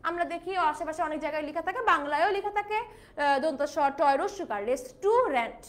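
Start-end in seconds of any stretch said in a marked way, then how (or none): 4.7-5.6 slow রেস্টুরেন্ট as res-TU-rent; common mispronunciation. Shown as a demonstration. Teacher spells out the Bengali reading, রেস্টুরেন্ট as slow res-TU-rent. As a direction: lean on "tu" in রেস্টুরেন্ট. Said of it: "কেন আমরা বলি?".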